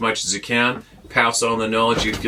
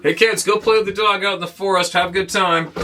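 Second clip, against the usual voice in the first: much higher breezy carefree father; careless dad; 8 semitones higher than usual. Retold or imitated. Neighbour dad sending kids to the forest, higher breezy. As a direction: toss it off like a dad who ignored the warning. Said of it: "doorknob two doors down".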